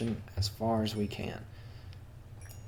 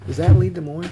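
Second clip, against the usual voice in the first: ordinary delivery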